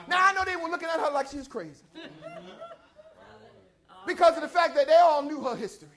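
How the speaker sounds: fast, offhand telling; preacher hurrying through a side remark to the church; quick aside. Real, not acted. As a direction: rattle it off quickly.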